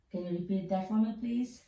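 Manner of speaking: ordinary delivery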